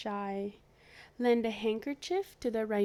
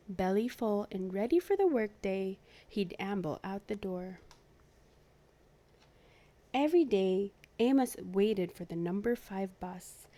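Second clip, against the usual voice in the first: usual speaking voice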